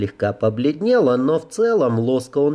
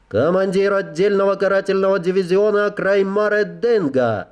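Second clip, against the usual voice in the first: clicky insect-like report; strange clicking voice; 9 semitones higher than usual. Acted. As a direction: rap it out in a strange chittering voice. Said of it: "отрапортовала она странным щёлкающим голосом".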